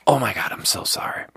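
breathless hushed sorry; whispered apology. Retold or imitated. Waiter apologizing to an upset diner, whispered. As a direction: whisper it, mortified.

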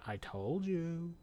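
slow, soft and unbothered told-you; very calmly. Retold or imitated. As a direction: let it land, slow and quietly smug. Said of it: "very calmly".

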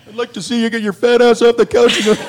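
put-on high taunting kid voice, laughing; mocking kid. Kid mocking dad from the basement, laughing. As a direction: sass back like a bratty kid.

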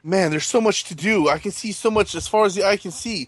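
wide-eyed wonder, rising into squeaks; awestruck explorer. Acted. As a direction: gasp at a huge world ahead. Staged intro skit about open map.

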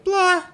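high, loud mocking "Blah."; mocking a dead line with a loud dismissive blah. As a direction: toss it off with a bored high blah.

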